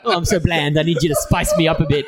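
lightly squeaky fame gremlin; mock a clingy gremlin begging. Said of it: "Gremlin voice you did?".

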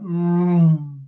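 long hummed engine drone; imitated car whoosh. Car passing the house, imitated with a drawn-out hum. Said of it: "a car went".

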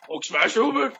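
high, dumb brute question; simple-minded monster asking; Hulk voice. Acted. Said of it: "the best Hulk and Thor argument".

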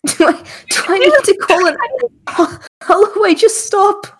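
high, loud and breaking into laughs; helpless laughter. Real, not acted. Begging a struggling friend to stop, helpless laughter. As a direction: plead through uncontrollable giggles.

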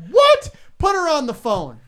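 very high, loud What, then an eager demand; almost screaming with glee; excited yelp. Retold or imitated. A radio host retells demanding the daughter on air, high and loud.

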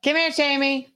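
high calling-out pet voice; calling the dog. Real, not acted. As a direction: call the dog across the room.

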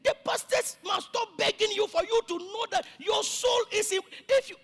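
high, loud, full-force rebuke; indignant preaching shout. Real, not acted. Pastor berating church members about their souls, shouting indignantly. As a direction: shout it out at the congregation.

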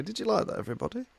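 high, lilting question to the crowd; high-pitched mock plea for approval; asking the audience. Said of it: "I laugh and say".

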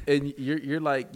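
usual speaking voice

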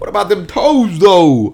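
high and drawn-out disbelief; mock incredulous voice. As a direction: ham up the shock. Repeat punchline in put-on voice. (incrédulo y exagerado)

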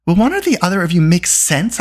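high, exasperated outburst; bursting out. A narrator's reading. Eilonwy to the bard and Dolly, exasperated outburst. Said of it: "Eilonwy burst out".

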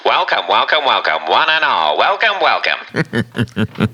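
slow vintage radio announcer; old-timey radio voice. Shown as a demonstration. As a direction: announce it like a 1940s radio host. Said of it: "I really wanted to do that in an old-timey radio voice".

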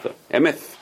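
"Th", then "Emeth" ending on that th; soft th. Shown as a demonstration. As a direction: model the th alone, then at the end of "Emeth". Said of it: "It's a th".

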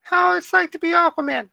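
very high-pitched imitation of a small child; cutest little kid voice. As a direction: put on a tiny kid's falsetto. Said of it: "like, the cutest little voices".

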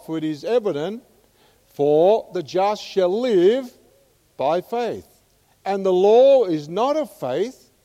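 loud preaching of the verse; rising pulpit reading; scripture read at full force. Acted. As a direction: proclaim the verse like a sermon.

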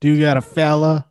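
a hint of imitated Southern US accent. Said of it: "has a Southern accent".

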